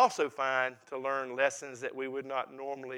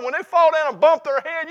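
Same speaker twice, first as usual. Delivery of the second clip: ordinary talk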